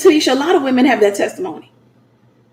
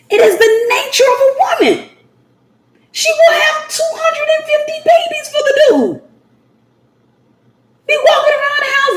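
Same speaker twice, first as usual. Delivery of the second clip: excited preaching; 13 semitones higher than usual. Real, not acted. Preacher whooping to the congregation, high and laughing.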